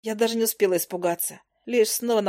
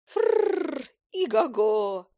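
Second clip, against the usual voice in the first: high trilled snort, then a whinny; mock horse neigh; 5 semitones higher, much slower than usual. Fairy jeering at the hunter as a stallion, mock neighing. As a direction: imitate a horse, rolling the R high.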